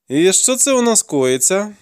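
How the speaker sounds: curious inquiry. A narrator's reading. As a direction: ask with drawn-out interest. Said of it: "поцікавився я".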